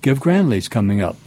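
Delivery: usual speaking voice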